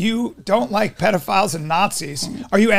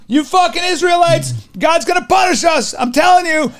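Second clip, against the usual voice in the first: higher, shouted rant of a crazed prophet; 6 semitones higher than usual. Retold or imitated. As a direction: yell the warning like a wild street prophet. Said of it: "he's banging on a cup going".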